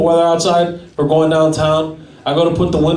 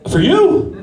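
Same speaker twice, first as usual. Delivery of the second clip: much higher, disbelieving, imitating the clerk; shocked clerk; 12 semitones higher than usual. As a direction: gasp it out in disbelief. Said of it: "He goes".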